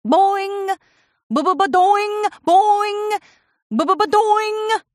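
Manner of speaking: high, loud cartoon boings; boing sound effects. Pinkie bouncing through the sky, high springy boing noises.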